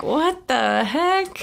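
high, drawn-out disbelief; mock disbelief. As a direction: stretch it out in shocked disbelief. Retold own reaction.

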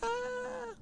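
faint high mock cry; mock kid's wail. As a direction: squeak it out like a hurt toddler. Kids hurt by milk in eyes.

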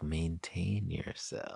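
slow, hushed, measured reminder; self-calming mantra. Retold or imitated. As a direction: draw it out softly to steady yourself. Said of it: "I literally think about it".

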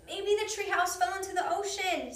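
loud, high worried shout. A narrator's reading. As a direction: call it out over the roar. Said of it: "shouted Jack".

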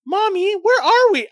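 put-on high, sing-out little kid voice; child in backseat. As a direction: pipe up like a puzzled child calling to mom. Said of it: "what do you hear in the backseat".

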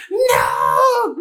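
loud, high drawn-out cry of no; hammed-up anguished "no"; mock wail.

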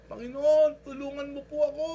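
high, loud plea sent upward; desperate calling out. Retold or imitated. Hanging man cries out to God for help. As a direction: call up to heaven for rescue. Man on branch calls heavenward.

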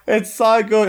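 high, laughing through the words; giggling delight. Real, not acted. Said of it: "laughing after the impression".

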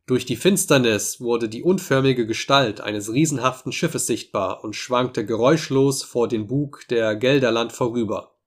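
ordinary delivery

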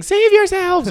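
high-pitched, loud cry of alarm; hammed-up screaming plant; mock panicked yell. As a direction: yell a warning as a doomed plant. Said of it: "It's plants yelling".

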